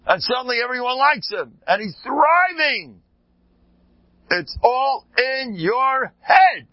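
high, loud and slow, each word landed; emphatic climax. Real, not acted. Rabbi to his audience, high, loud, slow emphasis. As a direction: drive the lesson home, slowing down. Sermon climax, driving the point home.

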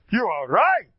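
loud, high, proud declaration; ringing, emphatic concession; proud, with a stolz. Shown as a demonstration. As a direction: declare it proudly, every word given full weight. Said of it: "I do it with a stolz".

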